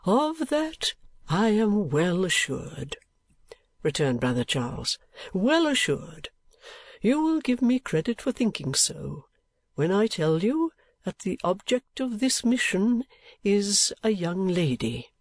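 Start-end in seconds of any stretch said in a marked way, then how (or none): 0.0-3.0 put-on higher-pitched, deliberate old gentleman; kindly old gentleman, confiding. Old gentleman employing Nicholas on a mission, confiding. 5.3-15.1 put-on higher-pitched old gentleman, easing down at the end; kindly old gentleman, assured. Old gentleman revealing the mission to Nicholas, assured.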